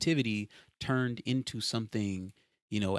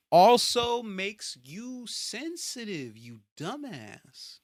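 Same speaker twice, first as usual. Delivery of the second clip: exasperated; 11 semitones higher, 4 dB louder, much slower than usual. Real, not acted. Ranting at critics.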